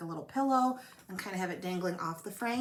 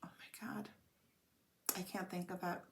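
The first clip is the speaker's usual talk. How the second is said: exasperated whisper; 10 dB quieter than usual. Real, not acted. Frustrated, can't remember name.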